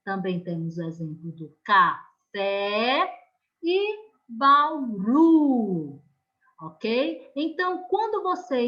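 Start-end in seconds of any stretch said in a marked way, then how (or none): 1.6-3.1 café split, with the fé drawn out; stress on final syllable. Shown as a demonstration. Teacher gives another last-syllable example, fé stressed in café. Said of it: "a sílaba forte aí na última". 4.3-6.0 Bauru slowed, with a long ru; stress on final syllable. Shown as a demonstration. Teacher gives a last-syllable example to pupils, ru held long in Bauru. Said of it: "a sílaba forte aí na última".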